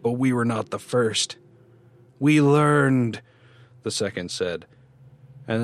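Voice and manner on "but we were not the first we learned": loud, rising ghostly voices; menacing ghosts. A narrator's reading. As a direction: chant it viciously.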